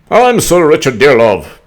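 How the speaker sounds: pompous mock spy-chief voice; hammed-up Brennan intro; John Brennan impression. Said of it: "I'm, that's John Brennan".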